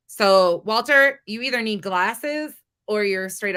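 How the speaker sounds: loudly calling out Walter. Real, not acted. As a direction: shout his name to get his attention. Calls Walter out directly.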